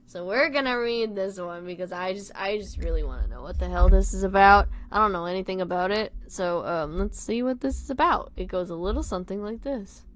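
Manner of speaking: plain reading voice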